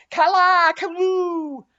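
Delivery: very high, loud howling cry. A narrator's reading. Queequeg answering the others, loud high howl. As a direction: howl it out wildly. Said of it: "howled Queequeg".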